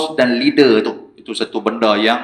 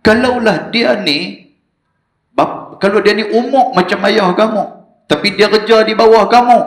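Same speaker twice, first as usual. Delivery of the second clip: higher, forceful sermon voice; fiery preaching; 8 semitones higher than usual. Acted. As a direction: drive the warning home to the congregation. (ceramah berapi-api dengan nada tinggi)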